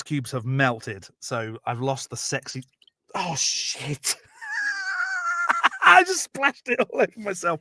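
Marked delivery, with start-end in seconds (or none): 4.4-5.8 sudden high cry of laughter; real burst of shocked laughing; yelping laugh at the spill; startled laugh. Real, not acted. Splashes bourbon on himself.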